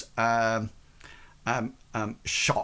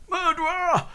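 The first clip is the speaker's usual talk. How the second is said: high, held-back mock shout; shout from the crowd. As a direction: call it out high, as if from afar. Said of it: "you hear somebody shout".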